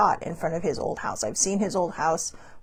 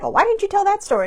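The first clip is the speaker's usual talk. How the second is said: high, quick, louder mock complaint; questioning sibling; 12 semitones higher, 5 dB louder, faster than usual. Older sibling questioning the storyteller, mock complaint. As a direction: pipe up as the sibling asking why.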